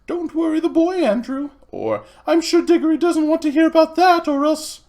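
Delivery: high, loud mock aunt voice; imitating Aunt Letty. Boy mimics aunt hushing uncle at meals, high and loud. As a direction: mimic the fussy aunt shushing. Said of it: "She says".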